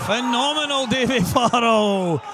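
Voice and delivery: loud, high cry with the name stretched out; elated commentary shout. Real, not acted. Commentator hailing the shot that levels the match, elated shout. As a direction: roar it in triumph, drawing out the name.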